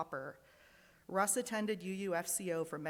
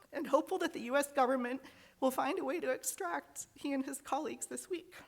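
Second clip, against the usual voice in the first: higher and hurried, fighting back tears; choked-up hope for her husband; emotional; 7 semitones higher, 3 dB louder than usual. Real, not acted. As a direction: push through real emotion, a little rushed. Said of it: "Sorry, I'm super emotional this morning".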